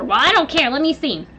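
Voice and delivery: insistent grandparent. Retold or imitated. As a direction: demand the child back. Acted quarrel, grandparent insists.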